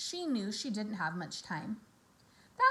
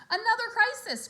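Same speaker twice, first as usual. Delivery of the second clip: mock groan; 12 semitones higher, 8 dB louder than usual. A narrator's reading. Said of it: "groaned Fern".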